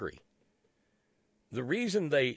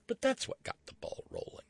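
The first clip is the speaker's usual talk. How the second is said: whisper; 13 semitones higher, 6 dB quieter than usual. Acted. Conspiratorial aside about the investigation.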